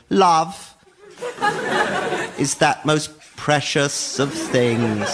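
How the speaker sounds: slow, drawn-out poem recital; mock-solemn poetry reading with long pauses. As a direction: parody a precious poetry reader, one word at a time. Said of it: "they put on their poetry voice".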